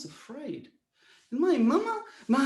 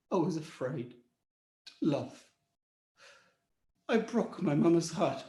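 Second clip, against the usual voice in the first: usual speaking voice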